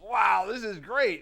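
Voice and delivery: high, slow, wide-eyed wow; amazed wow. Retold or imitated. Telling a friend about hearing the record, amazed. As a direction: savour the discovery, drawn out high. Amazed reaction while listening on headphones.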